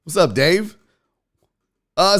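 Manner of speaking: normal talking voice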